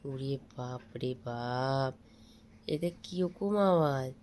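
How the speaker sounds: a drawn-out, exhausted oh my god; weary exclamation. Real, not acted. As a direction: sigh it out, worn down by the noise. Parent exasperated at baby's noise.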